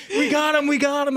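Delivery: high, loud, jubilant repeat; triumphant shout. Retold or imitated. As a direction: yell it out in triumph. Celebrating the kill.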